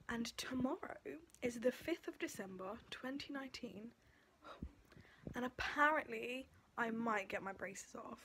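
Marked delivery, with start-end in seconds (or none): none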